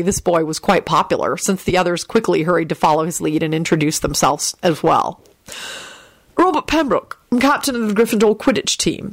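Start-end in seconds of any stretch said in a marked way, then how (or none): 6.4-9.1 put-on proud older schoolboy, pitched up. A narrator's reading. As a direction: announce it with a proud lift. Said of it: "the older boy told him proudly".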